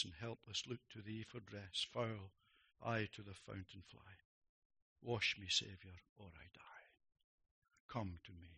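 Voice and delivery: soft, low, reverent recitation; hushed hymn recitation. Acted. Preacher quoting the old hymn to the congregation, hushed.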